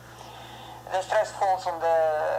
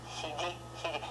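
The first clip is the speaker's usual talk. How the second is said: ordinary talk, nothing marked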